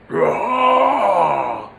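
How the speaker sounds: gruff creature cry; Acosta catchphrase. Shown as a demonstration. Trigger word to find Acosta's voice, a creature cry. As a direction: bark out a creature's rah. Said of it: "For Acosta, it's always".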